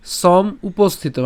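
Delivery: সম উপস্থিত drawn out slowly with সম lifted; slow pronunciation. Shown as a demonstration. Teacher demonstrating সম উপস্থিত to viewers, slow. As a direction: say সম apart, then উপস্থিত, slowly. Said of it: "আমি কি করলাম?".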